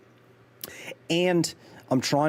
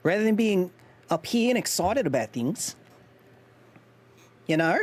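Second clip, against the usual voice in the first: excitable New Zealand accent pitched too high; 5 semitones higher than usual. Shown as a demonstration. Streamer showing the higher register, New Zealand accent. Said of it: "That's too high".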